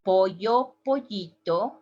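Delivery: pollo, pollito with a y, "poyo"; y sound. Shown as a demonstration. Teacher demos chick with y. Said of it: "we said".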